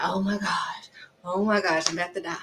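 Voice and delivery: whispered mock panic; hushed, hammed-up dread; dramatic whisper. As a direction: breathe it like you're about to die. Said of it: "I'm so dramatic".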